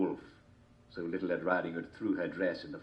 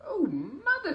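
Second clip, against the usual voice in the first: put-on girl voice; 4 dB louder, slower than usual. A narrator's reading.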